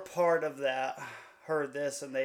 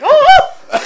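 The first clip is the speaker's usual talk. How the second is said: very high, loud panicked yelps; hammed-up burst of panic after holding it in; mock panicked scream; 17 dB louder than usual. Teller loses it after hanging up, mock panicked scream. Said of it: "She stayed calm the whole phone call. Hung it up".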